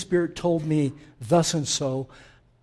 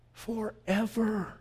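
tender whisper; 5 semitones higher, 6 dB quieter, much slower than usual. Acted. Softly ends Jesus's prayer.

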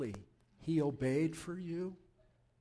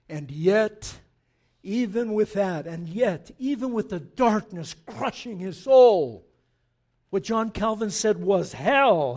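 preaching at full force; 9 dB louder than usual. Acted. Pastor preaches Christ's agony to the church, building loud. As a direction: drive it home from the pulpit.

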